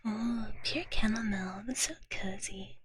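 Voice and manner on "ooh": normal talking voice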